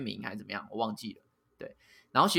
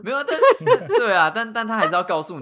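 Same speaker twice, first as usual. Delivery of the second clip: laughing; 9 semitones higher, 8 dB louder than usual. Real, not acted. Laughing at complaint about test.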